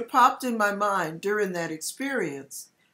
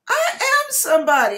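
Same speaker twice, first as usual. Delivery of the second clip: I am somebody, loud and higher; proud declaration; 11 semitones higher, 8 dB louder than usual. Retold or imitated. Declaring her worth to God, loud and proud. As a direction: proclaim it with full force. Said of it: "and I said".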